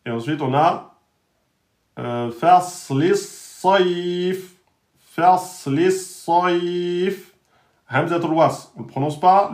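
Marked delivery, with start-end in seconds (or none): 2.0-7.3 "fasli saif" drawn out very slowly; slow recitation. Shown as a demonstration. Teacher recites slowly for students. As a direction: stretch the vowels in "saif". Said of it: "un temps et demi".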